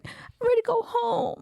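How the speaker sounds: high mock little-boy pout; high-pitched sulky child voice; sad child. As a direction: imitate a sad kid wanting out. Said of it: "he's still sad".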